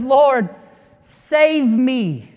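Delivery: loud, high plea from a sinking man; a hammed-up drowning man's call for help; desperate cry for help. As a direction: cry out as if going under. Peter's cry while sinking.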